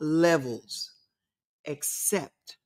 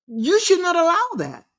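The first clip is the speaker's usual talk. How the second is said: emphatic; 12 semitones higher than usual. Real, not acted. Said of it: "preaching self-worth".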